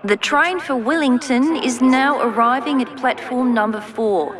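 high, far-off PA voice; station announcement. Acted. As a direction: read it like a loudspeaker announcement.

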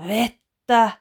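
loud, high, hoarse cry; father finds water in his hat, loud hoarse cry; strange hoarse voice. Acted. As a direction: croak it out in shock. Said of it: "hän sanoo oudon karhealla äänellä".

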